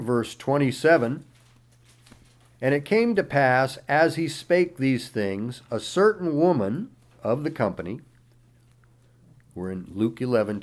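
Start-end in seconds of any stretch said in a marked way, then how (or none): 2.6-8.0 raised, declaiming Bible reading voice; scripture reading. Acted. Pastor reading Matthew aloud to the congregation, declaiming. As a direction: read the verses out like a preacher in full flow.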